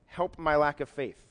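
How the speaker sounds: slow, drawn-out plea from a desperate father; mild mock cry of faith and doubt; the boy's father crying out to Jesus; a touch of a desperate cry. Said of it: "the boy's father cried out".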